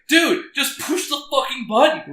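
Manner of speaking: high, loud, slow exasperated "dude"; imitating a fed-up coworker yelling. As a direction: shout it out, fed up and drawn out. Exasperated quote to slow client.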